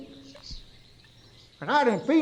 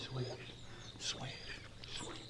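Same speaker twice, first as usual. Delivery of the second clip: swishing noise; 7 semitones lower, 21 dB quieter than usual. Retold or imitated. Mimics gator tail swishing.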